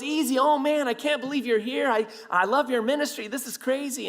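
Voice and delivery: high, excited fanboy voice; hammed-up delighted amazement; gushing fan. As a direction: imitate a starstruck officer gushing. Officer gushing as fan, retold.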